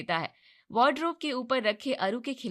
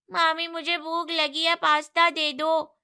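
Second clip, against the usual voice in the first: put-on whiny child; 6 semitones higher, 6 dB louder than usual. A narrator's reading. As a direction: demand it like a hungry kid. Child demanding food. (भूखे बच्चे की ऊँची ज़िद)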